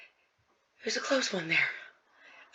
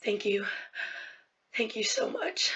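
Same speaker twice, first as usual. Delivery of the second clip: tearful thanks; 10 dB quieter, slower than usual. Acted. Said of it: "he's looking at you and goes".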